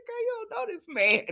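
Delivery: very high, incredulous squeak; amused disbelief. Real, not acted. Host tells the mother off, amused disbelief. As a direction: protest in a high voice, overcome by amusement. Host's amazed reaction to character.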